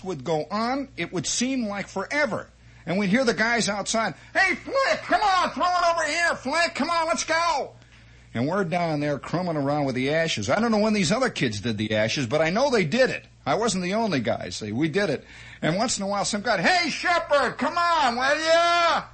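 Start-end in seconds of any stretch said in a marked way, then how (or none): 4.3-7.7 put-on impatient boys shouting a pal's name; kids calling. Neighborhood kids calling from outside. 16.6-19.0 high, loud shout from outside; a boy hollering up from the yard; kid calling. Retold or imitated.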